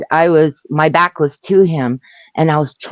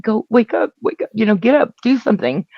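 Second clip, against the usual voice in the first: retold urgent plea; 6 semitones higher than usual. Urging boyfriend to get up.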